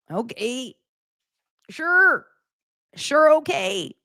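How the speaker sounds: high, drawn-out sarcastic humoring; sarcastic agreement. Real, not acted. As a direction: humor them in a high, dismissive voice. Sarcastic reply to the mocked complaint.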